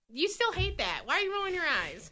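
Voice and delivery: ordinary delivery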